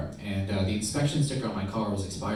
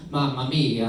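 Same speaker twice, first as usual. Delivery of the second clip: mock Italian; slower than usual. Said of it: "playing Italian to the cop".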